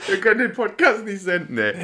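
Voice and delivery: high, crying with laughter; laughing. Real, not acted. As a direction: wail it through laughter. Laughing mock despair.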